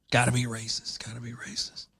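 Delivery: hushed mock refrain, fading out; mocking whisper. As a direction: whisper it like a knowing echo of the media.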